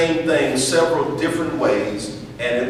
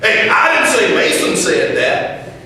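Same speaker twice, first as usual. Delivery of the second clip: loud, high, angry shout; 9 semitones higher, 7 dB louder than usual. Shown as a demonstration. Teacher shouting the line to show aggression. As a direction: yell it like you're confronting someone. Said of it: "I said the same thing three different ways".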